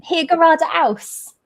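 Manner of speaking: slow, high hier gerade aus; English accent in German. Shown as a demonstration. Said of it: "It's German".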